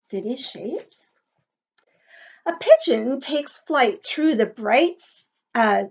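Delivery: lilting, sing-song picture book read; reading to children. Acted. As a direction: read the rhyme aloud to a class. Teacher reads picture book aloud.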